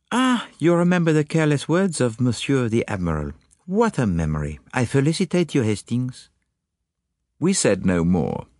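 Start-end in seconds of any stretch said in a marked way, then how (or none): 0.1-6.3 put-on high, accented detective, congratulating; Poirot. Detective praising Hastings's memory, high accented voice. Poirot's dialogue in narrated story.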